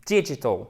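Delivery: "Digital" stressed on DI; English "Digital", strong start and falling end; first-syllable stress. Shown as a demonstration. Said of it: "c'est la place de l'accent tonique".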